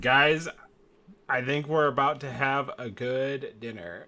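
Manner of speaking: lightly voiced, deliberate announcement to the gang; odd character voice. [name] telling the party about dinner, in an odd character voice. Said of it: "[name], what's this voice?".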